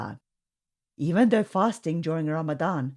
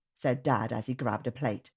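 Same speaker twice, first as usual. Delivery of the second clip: plain reading voice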